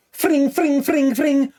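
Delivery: high playful fring noises; kid-like throwing-star sound effect; imitated ninja star whoosh. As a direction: make the whirring noise of thrown ninja stars. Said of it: "I remember going like this".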